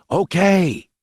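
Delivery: high, drawn-out call. A narrator's reading. Boy calling to hidden gunmen, calling out. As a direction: call OKAY out into the distance. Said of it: "But this time in a loud call".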